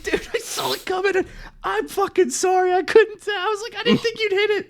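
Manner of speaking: high squeaky voice, laughing through the words; hysterical laughing apology. Retold or imitated. Apologizing to the fallen man, squealing with laughter. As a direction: apologize to the stranger while laughing helplessly. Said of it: "I'm hysterical".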